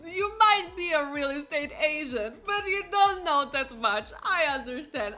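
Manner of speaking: put-on amused condescending old man. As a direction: talk down to her through laughter. Old man scolds the realtor.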